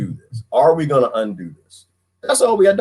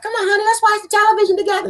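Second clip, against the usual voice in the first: very high, fast sweet voice; hammed-up high wife voice; mock wife; faster than usual. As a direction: coax husband to the couch. Wife asking to watch TV.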